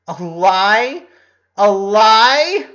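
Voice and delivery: loud, high, outraged repeat; furious disbelief. Acted. Ronnie Ann, outraged at Lincoln, shouting in disbelief. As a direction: hurl the words back at him.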